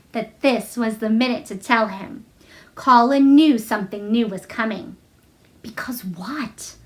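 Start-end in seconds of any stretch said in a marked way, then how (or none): 5.6-6.7 slow, breathless whispered question; eager whisper. Acted. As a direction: lean in and whisper, eager to know. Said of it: "he cried eagerly".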